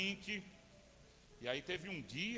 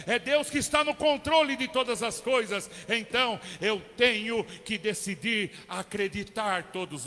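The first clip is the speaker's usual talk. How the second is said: louder, slower shouted declaration of faith; full-force preaching; 3 semitones higher, 9 dB louder than usual. Acted. Preacher declaring his decision to trust God, shouting. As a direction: thunder it out to the congregation.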